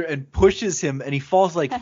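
ordinary talk, nothing marked

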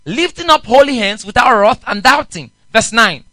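loud, ringing Bible reading; scripture reading. Acted. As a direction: proclaim the verse to the congregation. Preacher reads scripture aloud.

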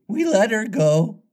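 high, pleased-with-himself sidekick; complacent. A narrator's reading. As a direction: report it high and untroubled. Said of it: "Smee answered complacently".

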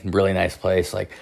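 usual speaking voice

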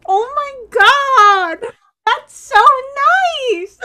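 overwhelmed fangirl squeal with a teary crack; mock teary squeal. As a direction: gush like the kindness nearly made you cry. Retold own emotional reaction.

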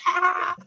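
a short silly shriek; cartoon scream of fake shock; high mock yelp. Joke cry after cheese touch.

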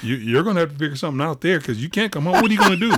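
high, loud no-nonsense teacher; stern teacher. Retold or imitated. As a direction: lay down the law like a strict mentor. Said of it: "Miss Pitts being Miss Pitts".

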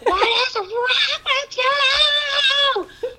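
shrieking, laughing, high-pitched and very slow; hammed-up lunatic mom meltdown, laughing through it; mock meltdown. Mom hamming up her own meltdown at the kids, shrieking. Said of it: "me literally having a meltdown".

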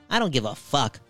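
ordinary talk

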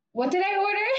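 high mock imitation of the customer; joking customers. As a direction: tease by putting on her voice. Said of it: "They were messing with me".

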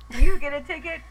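loud, high mock cop; mock cop shout. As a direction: bellow it like a game-show host. Mocking cops, loud.

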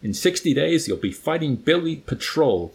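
understated officer's roar; roaring colonel. A narrator's reading. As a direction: read as a colonel's roar, somewhat restrained. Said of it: "the colonel with the big fat mustache roared".

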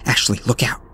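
urgent warning. A narrator's reading. Friend warns of creature behind her.

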